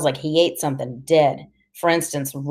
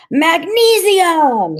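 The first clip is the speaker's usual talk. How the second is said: very high, loud, stretched-out call; excited kid's drawn-out shout; jubilant shout; 12 semitones higher, 6 dB louder, much slower than usual. A narrator's reading. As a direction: cry it out long and high over fireworks. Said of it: "he shouts".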